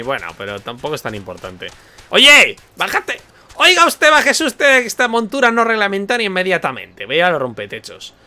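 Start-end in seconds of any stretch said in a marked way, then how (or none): 2.1-7.0 high, loud officious guard yelling at a rider; pompous guard. Pretend. Guard ordering a player off an illegal mount, loud and high. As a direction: bark orders like a fussy official.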